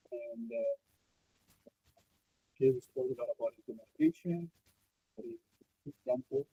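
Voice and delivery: muffled, distant microphone. Real, not acted. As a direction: voice dulled as if covered. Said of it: "you're a bit muffled there".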